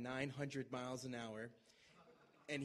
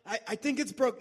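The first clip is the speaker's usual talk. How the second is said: imitating Justin in pain; 9 semitones higher, 12 dB louder than usual. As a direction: ham up the injured friend crying out. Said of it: "do the Justin imitation".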